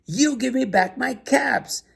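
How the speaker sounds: high-pitched demand for the caps; mock-stern demand; scolding peddler. A narrator's reading. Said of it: "he said".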